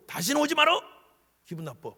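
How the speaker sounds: high and loud, then dropping; laundry owner snapping at a customer; mock rude shopkeeper. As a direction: shoo the customer away. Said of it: "이러더냐고".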